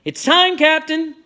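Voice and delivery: loud, high distant hail; calling up. A narrator's reading. Soldier calling up from the river, loud high shout. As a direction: call it out across a distance. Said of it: "someone called up from the river".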